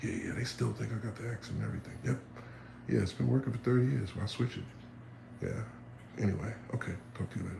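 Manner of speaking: soft, fast hushed aside; whisper-quiet offhand chat; mock private talk. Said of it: "And they be like".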